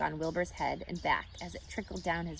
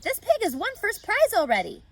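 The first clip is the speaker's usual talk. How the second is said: excited; 12 semitones higher, 8 dB louder than usual. A narrator's reading. Avery reads the blue tag.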